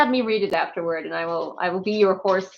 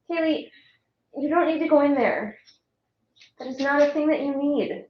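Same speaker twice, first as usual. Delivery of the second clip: higher sing-song voice for the dog; talking to the dog; 7 semitones higher than usual. Real, not acted. Streamer coaxing her dog away from a room, pitched up.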